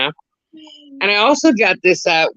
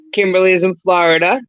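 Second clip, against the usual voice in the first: normal talking voice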